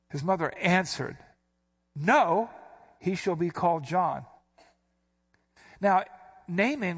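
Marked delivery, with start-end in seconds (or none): 1.9-4.3 sharp, startled No, then he shall be called John; abrupt emphatic no. Shown as a demonstration. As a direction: snap out the No like a sudden reaction. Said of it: "why do I keep inflecting like that".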